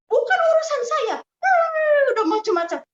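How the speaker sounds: very high, loud screamed refusal; retold angry yelling. Preacher acts husband refusing to pay the debt, angry yelling. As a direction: yell it high, imitating a furious husband.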